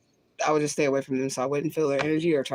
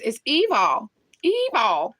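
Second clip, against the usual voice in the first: Tracy Barbie's evil; 5 semitones higher, much slower than usual. Retold or imitated. Imitating how a YouTuber says evil, higher. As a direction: mimic a dramatic "It's evil", stretched and pitched up. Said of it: "gonna say evil like Tracy Barbie".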